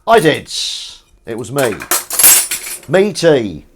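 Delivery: proud claim. Pretend. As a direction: blurt it out proudly. Proudly claims the tea, then slip.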